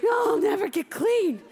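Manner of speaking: high-pitched despairing cry; distraught wail. Acted. Hamster answers the worried rookie, distraught wail. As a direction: wail it as if beyond help.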